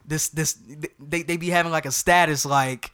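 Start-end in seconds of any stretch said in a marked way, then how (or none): none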